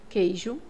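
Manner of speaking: queijo with no U sound, plain k before the e; silent U. Shown as a demonstration. Teacher shows learners the word queijo, U silent. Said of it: "você não escuta o som do U".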